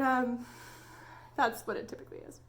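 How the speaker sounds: usual speaking voice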